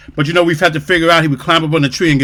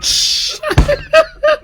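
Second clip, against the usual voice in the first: howling laugh. Real, not acted. Laughing hard.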